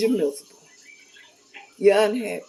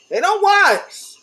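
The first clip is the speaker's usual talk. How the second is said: indignant; 10 semitones higher, 5 dB louder, slower than usual. Real, not acted. Complaining about rude drive-thru workers.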